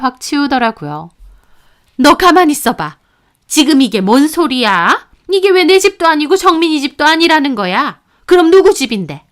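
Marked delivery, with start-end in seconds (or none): 2.0-9.2 demanding questions. A narrator's reading. Mother-in-law demands explanation.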